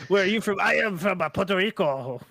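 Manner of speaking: comic fake Japanese accent. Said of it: "you sound like you're putting on a Japanese accent".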